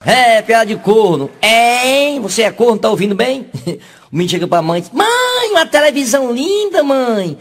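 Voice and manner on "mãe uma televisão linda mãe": put-on very high little boy voice; the boy asks his mother, pitched up high like a kid; a squeaky child, much higher.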